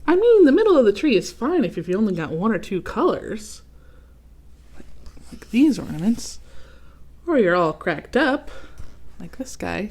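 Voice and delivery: lightly voiced arrogant ornament; snooty Otto. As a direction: look down on the others.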